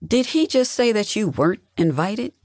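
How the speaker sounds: high, disbelieving question; incredulous. A narrator's reading. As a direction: ask it as if shocked. Sister shocked by overheard news.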